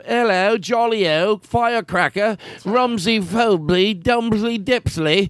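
high, slow singsong British nonsense; exaggerated British accent. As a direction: rattle off silly mock-British words, drawn out. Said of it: "doing the accent".

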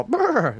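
high rolled lip trill brr; knight noise. Retold or imitated. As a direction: trill the lips like a horse. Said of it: "they instinctively go".

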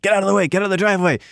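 urgent, high-pitched mock yell; freaked-out security. Security freaking out as the cars arrive, high urgent mock shouting. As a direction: imitate guards frantically clearing people away. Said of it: "security people are freaking out".

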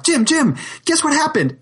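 high, thrilled calling out; excited calling. Retold or imitated. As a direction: shout his name with excitement. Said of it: "And they're like".

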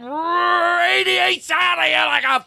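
high, forceful gushing rant; aggressive love. Pretend. Host praises the listener's soul, aggressively loving. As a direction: hammer them with compliments, ending in a shout. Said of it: "throw love at them aggressively".

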